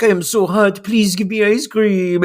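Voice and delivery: hammed-up coy begging; mock seductive pleading. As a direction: wheedle for a treat, flirting hard. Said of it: "she, like, shows her shoulder to him".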